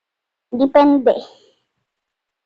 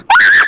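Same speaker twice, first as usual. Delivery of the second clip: short, very high-pitched screech; 4 dB louder than usual. Real, not acted. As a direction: squeal out in protest. Protesting screech during game.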